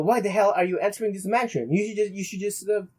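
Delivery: usual speaking voice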